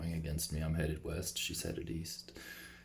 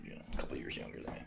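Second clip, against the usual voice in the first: whisper; 10 dB quieter, much faster than usual. Real, not acted. Aside about the woman's age.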